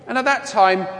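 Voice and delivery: usual speaking voice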